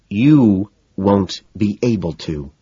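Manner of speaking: slow, controlled, tense reply. A narrator's reading. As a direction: hold back, spacing the words tightly. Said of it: "her voice tense and lowered".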